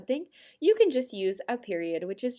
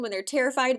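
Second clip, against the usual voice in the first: ordinary talk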